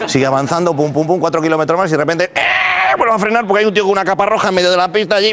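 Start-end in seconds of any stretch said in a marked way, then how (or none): 2.3-2.9 high squealing brake noise; imitated brake screech. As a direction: squeal it like tyres braking hard. Braking car again.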